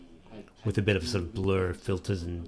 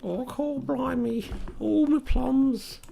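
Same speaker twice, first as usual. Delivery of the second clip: high, slow mock Cockney exclamation; Cockney accent, playfully imitated; 4 dB louder, much slower than usual. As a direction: ham up a Cockney gent. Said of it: "Cockney English accents".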